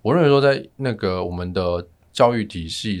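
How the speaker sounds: ordinary talk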